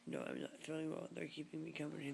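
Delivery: ordinary delivery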